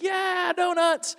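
high mock kid cheer. Kids hearing they get donuts, high mock cheer. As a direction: squeal it like an excited little kid.

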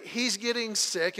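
normal talking voice

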